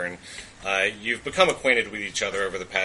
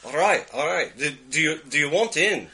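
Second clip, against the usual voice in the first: higher Scottish voice pressing for an answer; Scottish accent, pitched up; 6 semitones higher, 4 dB louder than usual. Acted. Cousin asks if adventurer wants in, higher Scottish accent. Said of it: "the accent".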